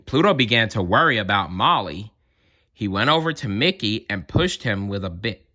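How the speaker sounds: ordinary delivery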